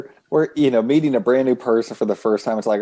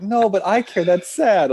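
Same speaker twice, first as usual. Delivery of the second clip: higher, mock-pitying protest; pitying friend; 8 semitones higher than usual. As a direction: sound sorry for him. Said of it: "she's like".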